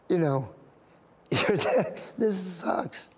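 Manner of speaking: embarrassed, choked-up aside; fighting happy tears; apologetic laugh through tears; really tearing up. Real, not acted. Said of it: "I'm sorry. It just makes me happy".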